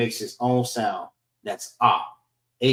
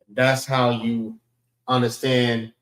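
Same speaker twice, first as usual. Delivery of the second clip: normal talking voice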